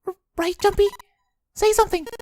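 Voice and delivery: put-on high, anxious girl's voice; a worried girl pleading; young girl voice. Voices June.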